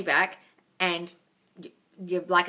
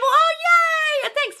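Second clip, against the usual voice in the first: high, loud gleeful squeal; delighted squeal; 7 dB louder, much slower than usual. Real, not acted. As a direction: squeal with delight at the good news. Gushing over a viewer's comment.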